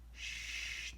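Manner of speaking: soft shush for quiet. Acted. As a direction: shush gently to quiet the listener. Hushing the reader.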